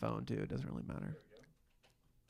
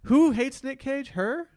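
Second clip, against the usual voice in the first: incredulous; 10 semitones higher, 7 dB louder, slower than usual. Real, not acted. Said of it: "That was weird".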